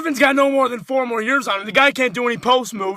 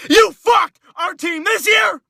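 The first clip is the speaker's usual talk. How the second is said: furious slow shout; 6 semitones higher, slower than usual. Real, not acted. Fan raging at traitor player.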